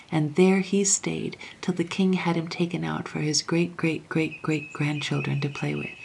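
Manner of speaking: ordinary delivery, nothing marked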